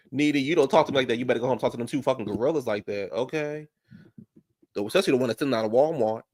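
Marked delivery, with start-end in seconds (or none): 0.1-3.7 fast retort. Retold or imitated. Voicing a retort.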